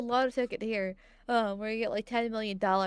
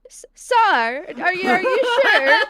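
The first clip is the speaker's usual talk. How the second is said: higher, louder teasing voice; playful put-on voice, louder; teasing mock voice; 7 semitones higher, 13 dB louder, slower than usual. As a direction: needle him in a put-on voice. Teasing in Indian voice.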